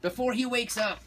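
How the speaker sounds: high-pitched warning; ordered. A narrator's reading. Mama warns her kitty about the sleeping dog, ordering. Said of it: "ordered Mama".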